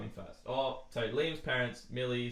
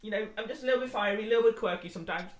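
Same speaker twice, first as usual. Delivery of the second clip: mock Faye voice; 9 semitones higher than usual. Voicing Faye describing herself.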